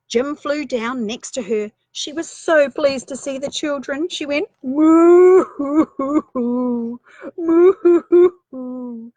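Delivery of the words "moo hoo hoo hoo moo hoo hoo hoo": slow, loud sobbing moo; a cow mooing and weeping with joy; drawn-out blubbering cow moo; crying moo. Acted. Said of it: "and cried".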